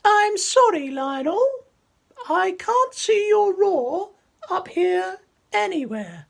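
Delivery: very high, slow, lofty giraffe; squeaky giraffe apology. Acted. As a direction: stretch up high and sing it sorry.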